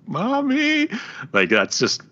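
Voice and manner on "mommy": put-on high, loud kid's scream for mommy; shrill imitation of a screaming child. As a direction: mimic a child screaming out, pitched way up. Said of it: "the kid screaming".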